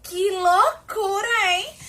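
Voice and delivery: high-pitched, drawn-out exclamation of disbelief; high, slow mock astonishment; acted amazement. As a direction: act overwhelmed, back from a crazy adventure. Acts dazed return from a game.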